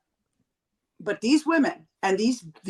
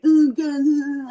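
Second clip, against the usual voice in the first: higher, louder dopey gun-lover voice; mocking gun fan; 5 semitones higher, 5 dB louder than usual. Mocking gun-loving politicians, a dopey "ooh" of delight. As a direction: ham up a simple gun enthusiast. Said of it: "I feel like they're like".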